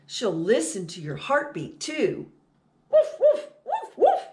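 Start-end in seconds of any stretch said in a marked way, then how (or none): 2.9-4.2 high, loud playful puppy woof; puppy barking. Acted. Teacher reading to children, barking as the puppy: high loud woofs. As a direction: bark like an excited little dog.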